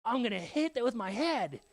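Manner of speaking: put-on high, laughing kid wonder; awed kid. Kid watching the ball fly, high laughing awe. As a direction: gasp like an amazed child. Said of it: "you're like".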